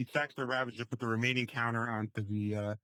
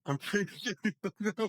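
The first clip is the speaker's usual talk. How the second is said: high-pitched, laughing through the words; 8 semitones higher than usual. Real, not acted. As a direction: let laughter break up the line. Amused reaction to match outcome.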